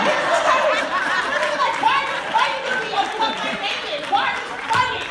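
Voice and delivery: half-laughing, demand why they're arguing; mock mad rant. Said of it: "That's really how I get mad".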